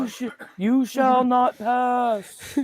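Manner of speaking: high, drawn-out movie quote; high, hammy fantasy-film line; mock dramatic command. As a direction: proclaim it slowly like an epic showdown. Acts out Lord of the Rings line.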